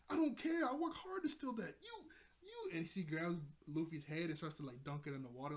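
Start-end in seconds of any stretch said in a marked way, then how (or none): 0.1-2.8 high-pitched mock fury; acted-out angry character voice; furious protest. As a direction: argue back indignantly, voice way up. Nami furious in acted quarrel.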